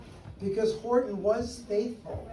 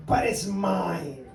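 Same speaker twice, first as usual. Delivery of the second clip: angry scream; 4 dB louder, much slower than usual. Acted. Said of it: "screamed the bird".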